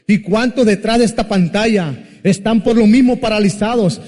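preaching at full force. Acted. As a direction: preach it out to the screen. Preacher exhorts the online audience.

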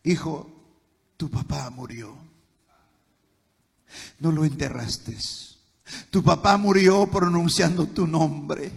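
mother's grieving news. Retold or imitated. Mother telling her son his father died, hushed and sad. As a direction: break the news gently, barely voiced. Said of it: "¿Y sabe qué me dijo ella?".

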